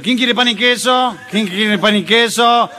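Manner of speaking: put-on slow, loud, menacing drunk taunt; drunk bully. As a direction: drag out the question like a threat. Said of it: "entró chupado medio violento". (borracho violento desafiando a todos, lento y fuerte)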